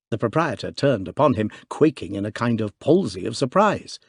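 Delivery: ordinary delivery, nothing marked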